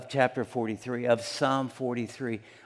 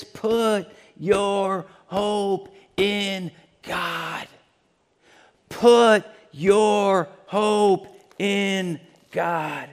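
slow resolve; 6 semitones higher, 5 dB louder, much slower than usual. Acted. Preacher voicing psalmist's resolve.

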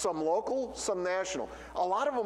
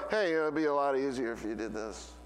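lower, know-it-all helper voice, lightly put on; know-it-all Captain Obvious; 7 semitones lower than usual. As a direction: chime in like a smug busybody. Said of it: "Captain Obvious who comes waltzing around".

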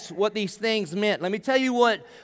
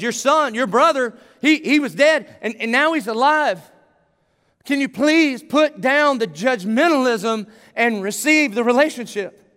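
begging, pleading; 5 semitones higher, 4 dB louder than usual. Acted. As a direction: plead with your son, growing more urgent. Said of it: "he's begging his son".

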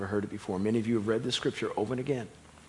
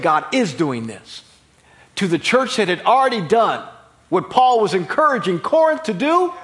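loud preaching, rising in pitch and force; preaching at full force; 6 semitones higher, 9 dB louder than usual. Real, not acted. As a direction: build to a pulpit climax.

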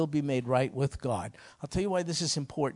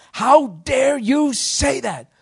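slow, much higher and louder indignant cry; retold outrage; 10 semitones higher, 9 dB louder, much slower than usual. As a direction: hurl each word out in outrage, slowly. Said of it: "He went ballistic".

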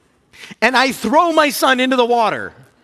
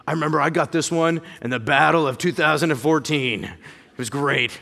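put-on old war veteran; 7 semitones lower than usual. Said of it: "it's like a war wound, man".

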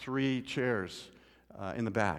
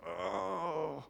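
groan; 4 semitones higher, 10 dB quieter than usual. Retold or imitated. Drunk man groaning at the police, mock groan. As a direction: let out a weary groan. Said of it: "He just".

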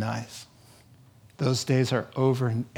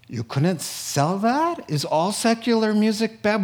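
mock critic; 7 semitones higher than usual. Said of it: "They said".

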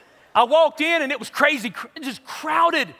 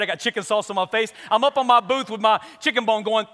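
normal talking voice

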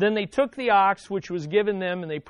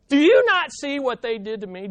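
loud, higher complaint, "Do you not see"; indignant cry to God; 5 semitones higher, 6 dB louder than usual. Retold or imitated. Believer complaining to God, loud and indignant. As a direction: call out to God, wounded. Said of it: "don't you sometimes feel like".

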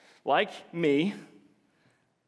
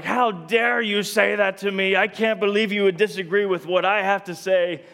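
re-enacted indignant outburst, higher and louder; defensive anger; 4 semitones higher, 5 dB louder than usual. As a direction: act out taking offence in a heated argument. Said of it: "I responded in complete defensiveness and anger".

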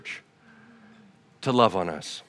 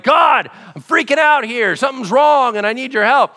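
higher, loud cry for help; panicked plea; 9 semitones higher, 6 dB louder than usual. Acted. Person hanging on a log shouting to God for help, panicked. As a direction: yell to God while clinging to the log. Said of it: "you're like".

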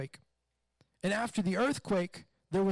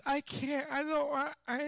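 put-on whiny kid; 7 semitones higher than usual. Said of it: "when their kid's just".

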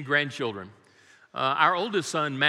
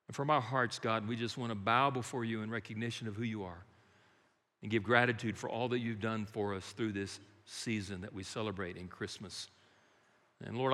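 hushed, reverent opening prayer; 5 semitones lower, 7 dB quieter than usual. Acted. Pastor praying quietly over the congregation before the sermon. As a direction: pray softly over the congregation.

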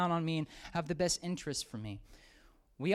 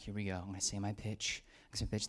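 nervous whisper; 8 semitones lower, 9 dB quieter, faster than usual. Retold or imitated. Nervous self-talk at the door.

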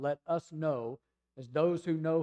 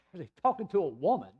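acted disbelief with a laugh before it; higher, mock-incredulous repeat; incredulous echo; 3 semitones higher, 4 dB louder than usual. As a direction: throw the words back as an outraged question. Father repeats the answer in disbelief.

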